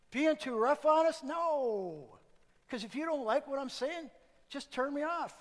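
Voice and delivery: high and loud, teasing challenge; loud playful retort. Real, not acted. Preacher daring listeners to turn him off. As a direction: throw it back at them loud.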